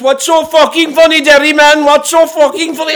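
high, loud mock roar at a laughing teammate; imitating an angry yell. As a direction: shout it like a raging big man, half in fun. Angry teammate yelling at speaker.

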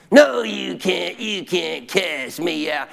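very slow, nasty demonic taunt. Retold or imitated. Demon taunting the pastor, slow and nasty. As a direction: stretch the words out as a sneering demon. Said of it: "as nasty as he did".

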